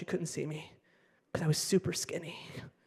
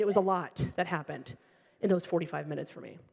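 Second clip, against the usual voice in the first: ordinary talk, nothing marked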